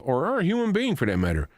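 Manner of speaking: ordinary talk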